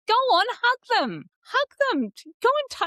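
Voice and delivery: high mocking nagging voice, slow at first; mock a relative pushing a kid into a hug; pushy adult. To a child, a high mocking nag to hug relatives.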